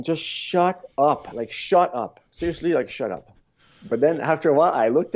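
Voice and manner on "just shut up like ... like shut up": higher, slow, exasperated "shut up"; mock-exasperated thought aimed at the chatty Italian; annoyed inner voice. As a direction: drag it out like you're fed up with him. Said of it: "I'm just thinking".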